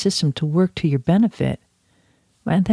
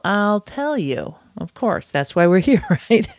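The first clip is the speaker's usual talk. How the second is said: ordinary talk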